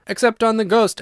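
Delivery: gleeful high squeal on ghost; shrieking. A narrator's reading. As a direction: squeal it out, teasing. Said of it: "shrieked the twins as they capered about".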